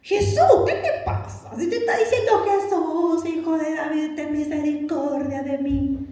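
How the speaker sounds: very high, squeaky exasperation; mock indignation. We who analyse everything scolding Jesus, squeaky mock exasperation. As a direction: protest in a comically high voice, stretching the end.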